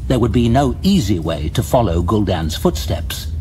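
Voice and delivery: usual speaking voice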